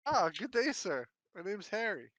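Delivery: chirpy would-be private eye, talking up a stranger; detective Harold. Acted. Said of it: "he says".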